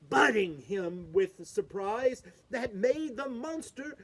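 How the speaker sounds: ordinary delivery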